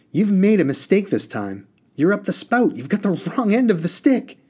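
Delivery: high, loud, insistent comeback; heated retort. A narrator's reading. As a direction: argue back in a rising fluster of set phrases. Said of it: "Harun retorted".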